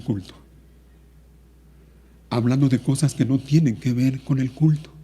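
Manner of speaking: soft, sorrowful admonition; quiet, solemn pulpit appeal; grave, subdued preaching. Real, not acted.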